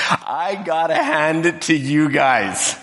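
a host raving about the wine, drawn out; delighted master of ceremony. Retold or imitated. Master of ceremony praising the bridal couple, slow high delighted.

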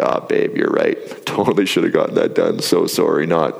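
mock-sweet apology with a laugh, then a flat "not"; sarcastic apology. Husband brushing off his wife's reminder, mock-sorry and sarcastic. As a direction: fake sorry to his wife, tongue in cheek. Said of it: "And I'm like".

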